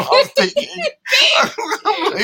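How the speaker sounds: high, loud laughter through the words; can't stop laughing at the slip; laughing hard. Real, not acted.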